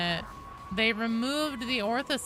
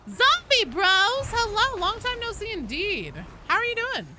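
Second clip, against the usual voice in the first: excited greeting; 12 semitones higher, 7 dB louder than usual. Real, not acted. Streamer hailing a long-absent viewer, excited greeting. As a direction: call out a big welcome to a returning regular.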